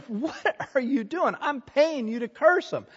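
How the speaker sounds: high, soft, mock exasperation; incredulous protest. King to the prophet he is paying, incredulous protest.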